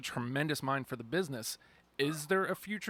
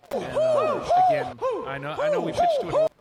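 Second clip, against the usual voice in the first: laughing high squeal; 9 dB louder than usual. Real, not acted. Laughing squeal while talking.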